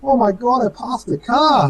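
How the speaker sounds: high, amazed exclamation, slowing at the end; retold astonishment. Racer retelling his shock at passing a car, amazed. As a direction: replay the moment of disbelief.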